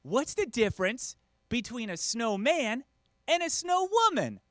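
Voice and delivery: put-on slow kid voice, climbing high at the end; imitated schoolboy. Boy tells career-day riddle, slow high kid voice. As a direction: set up the joke slowly like a second grader. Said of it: "boy in the class raises his hand".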